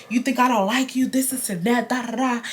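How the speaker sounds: plain reading voice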